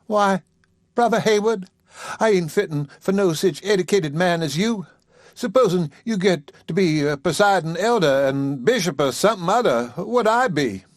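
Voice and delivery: bashful lady protesting modestly; modest Sally. Acted. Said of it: "her eyes sought the floor modestly".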